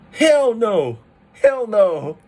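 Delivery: high, loud exclamation with a sharp fall; hammed-up shocked refusal. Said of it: "you're kinda shocked".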